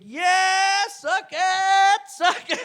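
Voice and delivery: high-pitched taunting gloat; cocky gloating. Pretend. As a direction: rub it in like a smug winner. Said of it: "you're all cocky".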